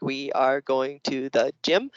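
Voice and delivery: slow, separated words, one per finger; word-by-word counting. Shown as a demonstration. Teacher showing how kids count words on their hands, slow and separated. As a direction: count each word out slowly, rising on gym. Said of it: "the kids counted on their hands, like".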